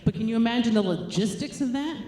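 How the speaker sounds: usual speaking voice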